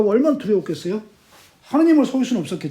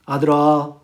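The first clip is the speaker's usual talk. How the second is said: low call to a son; lightly voiced father's ghost; 5 semitones lower, slower than usual. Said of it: "홀령이 얘기를 합니다". (나직하게 부르는 아버지 유령)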